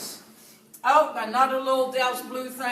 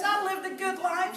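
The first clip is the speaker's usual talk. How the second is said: high-pitched cheerful acceptance; upbeat; 10 semitones higher than usual. Retold or imitated. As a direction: imitate upbeat mom, pitched up. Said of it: "she was so upbeat".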